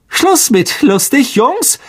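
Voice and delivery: put-on high, smug teacher turning on the boys; gleeful villain dropping the act; Lockhart's voice. A narrator's reading.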